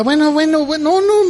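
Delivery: a fed-up mother winding herself up; exasperated mother. Retold or imitated. Pastor retelling the mother's complaint, mock exasperated. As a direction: voice climbs high, piling up complaints. Mother listing her son's faults.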